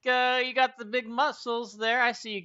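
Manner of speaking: high, loud, swaggering challenger; Juwila, brash warrior host. Acted. Juwila challenging the party to a strength contest: loud swagger. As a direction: taunt them into the contest.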